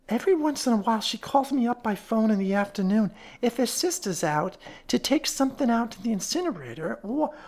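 high, rambling character voice; fat woman, uneasy. Acted. Fat woman explaining her errands for the invalid, uneasy. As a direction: ramble on as a folksy caretaker's wife.

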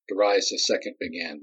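plain reading voice

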